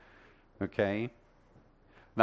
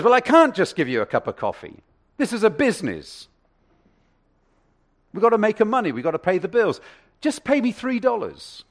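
high, loud, insistent shop assistant; firm cashier; 11 semitones higher, 11 dB louder than usual. Acted. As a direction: refuse him firmly, voice up high. Rachel refuses free coffee.

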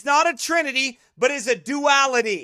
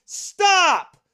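shouted stop; 4 semitones higher than usual. Real, not acted. Cuts in on the video.

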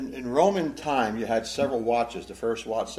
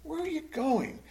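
high-pitched, wounded plea; hurt pleading; 9 semitones higher than usual. Acted. Peter asking his Lord where he is going, hurt pleading. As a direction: plead as if hurt at being left behind. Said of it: "Hear the hurt in this".

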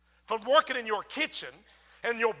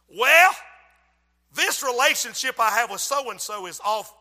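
loud indignant pushback; defiant retort; 9 dB louder than usual. Acted. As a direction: push back on God, loud and stubborn. You arguing with Holy Spirit.

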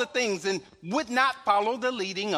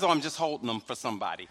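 innocent deep reply; 11 semitones lower, faster than usual. Retold or imitated. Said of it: "Jonetta, why you got those guns?".

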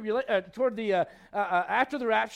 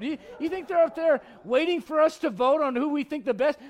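usual speaking voice

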